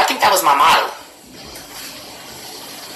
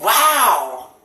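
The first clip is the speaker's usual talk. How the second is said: stunned reaction; 6 semitones higher than usual. Retold or imitated. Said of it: "I'm like".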